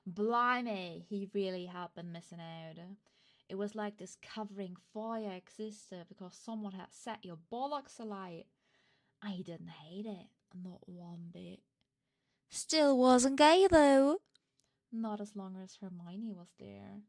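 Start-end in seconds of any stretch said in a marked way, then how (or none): 0.1-11.6 a hint of a downtown London accent, loud; lightly put-on Cockney read; leaning into a mild London accent. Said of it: "for the most downtown London accent". 12.5-14.2 put-on loud, slow London-accent declaration; booming downtown London accent. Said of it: "for the most downtown London accent".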